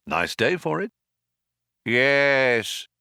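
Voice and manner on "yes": a hint of a passing maniac; drawn-out word, oddly voiced, as a mild maniac; lightly voiced maniac. A narrator's reading. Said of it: "said a passing maniac".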